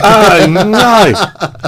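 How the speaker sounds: high, drawn-out mock Australian accent. Co-host joins the Australian accent bit, hammed-up Aussie. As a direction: stretch it into an exaggerated Aussie twang.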